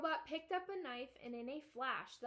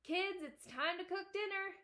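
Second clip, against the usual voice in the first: higher, louder holler up the stairs; 7 semitones higher, 4 dB louder than usual. A narrator's reading. Father calling upstairs to his kids, hollering.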